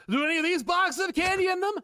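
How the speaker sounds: put-on high, loud hopeful kid voice; a little boy hoping for candy; Milhouse voice. Milhouse asking the box man hopefully, high and loud. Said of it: "And then Milhouse goes".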